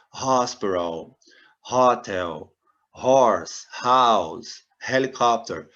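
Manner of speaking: each word with a breathy H like an R; model pronunciation. Shown as a demonstration. Teacher lists English H words for students, audible H. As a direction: list words, pushing air on each H. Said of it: "o H com som de R".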